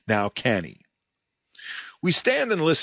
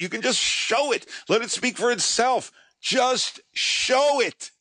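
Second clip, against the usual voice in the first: higher, urgent, almost bouncing with frustration; retelling an eager plea to the producer, pitch way up; excited pleading; 6 semitones higher than usual. Retold or imitated. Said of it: "I am all but jumping up and down".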